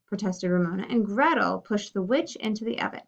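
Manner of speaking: usual speaking voice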